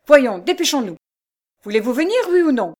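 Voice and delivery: loud, high, hectoring demand; brutal impatience. A narrator's reading. Stranger seizes Jeanne's wrist, brutally impatient. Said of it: "il ajouta brutalement".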